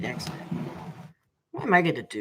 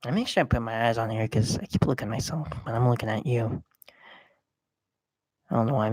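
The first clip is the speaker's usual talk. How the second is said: normal talking voice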